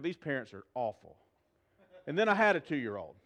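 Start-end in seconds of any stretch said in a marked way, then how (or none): 0.0-1.2 much softer snide aside; a quiet, judgy aside about awful parents; muttered judgment. Retold or imitated. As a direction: mutter it under your breath.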